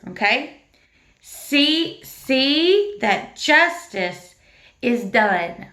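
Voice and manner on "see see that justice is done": slow, drawn-out verse recitation. Shown as a demonstration. Teacher leading children in a slow verse chant. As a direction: lead the class slowly through the verse. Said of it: "we're gonna do like this for justice".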